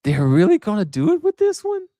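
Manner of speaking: mock shock, pitch leaping up; shocked disbelief. Host retells his reaction to the arrest news, shocked and high. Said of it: "I was shocked".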